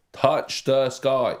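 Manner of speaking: loud, high, drawn-out title; all-caps exclamation. Acted. Reading out a title typed in all caps, loud and stretched. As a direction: call it out slowly as if in capitals. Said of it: "It was in all caps".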